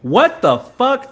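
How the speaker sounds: loud, high, drawn-out disbelief; shocked outburst. Real, not acted. Watching a backboard three go in, loud stunned disbelief. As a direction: yell it slowly, pitch shooting way up.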